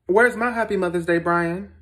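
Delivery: loud, high, put-out question; indignant demand. Acted. Stepmother left out of Mother's Day greetings, indignant demand.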